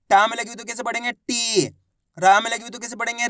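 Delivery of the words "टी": "टी" with the ई stretched; long drawn-out ī. Shown as a demonstration. Teacher giving learners an example, "टी" drawn out. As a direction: hold the vowel of "टी" long. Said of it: "बड़ी ई की मात्रा को खींच के पढ़ा जाता है".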